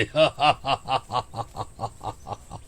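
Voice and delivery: deep, fading demonic laugh; a devil's triumphant laugh; evil devil cackle. Acted. (शैतानी ठहाका)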